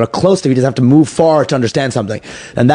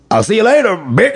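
Cosby; 9 semitones higher than usual. Retold or imitated. Retelling a husband's parting insult to his wife, in mock impression. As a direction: put on a hammed-up high comedian voice. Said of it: "but in the Cosby accent".

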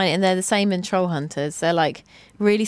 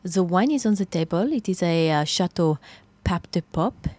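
a light French waiter voice; French accent. Acted. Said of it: "I am French".

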